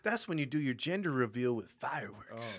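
loud mock Southern weatherman; Southern accent. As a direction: announce it with a put-on Southern twang. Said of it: "why is he Southern?".

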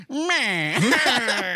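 high, loud nasty-little-villain squawk; mock cartoon villain. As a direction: squawk it like a comic-book henchman. Mimics villain poking with umbrella.

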